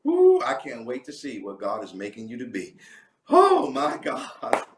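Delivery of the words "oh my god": high, slow, laughing gasp; overjoyed exclamation; overcome with joy. Real, not acted. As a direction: break into giddy laughter. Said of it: "I am so excited".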